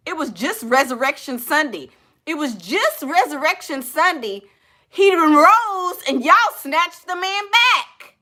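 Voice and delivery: high-pitched, worked-up church-lady outrage; indignant scolding. Real, not acted. Mock scolding viewers over Resurrection Sunday.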